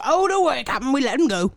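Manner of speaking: high, loud cartoon henchman; Smee impression. Smee reporting to his captain, hammed-up henchman voice. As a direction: play the reassuring sidekick, loud and high at first. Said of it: "And he's like".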